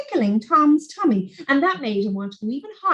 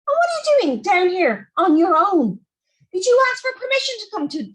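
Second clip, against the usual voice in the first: cross father; 6 semitones higher than usual. Acted. Daddy scolding children.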